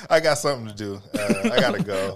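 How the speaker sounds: mock hasty exit, half laughing; laughing mock excuse. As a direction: make a fake excuse while amused. Playing along with fake excuses.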